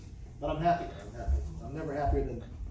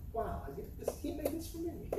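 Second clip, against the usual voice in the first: awed whisper; 9 semitones higher, 9 dB quieter than usual. Retold or imitated. Awed inner thought.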